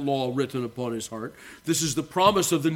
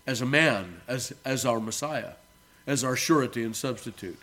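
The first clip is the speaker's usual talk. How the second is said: ordinary talk, nothing marked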